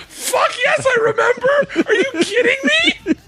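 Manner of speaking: high, drawn-out quote through real laughter; squealed punchline, laughing throughout; laughing retelling. Retold or imitated. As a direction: act her amazement while cracking up. Cracking up at retold punchline.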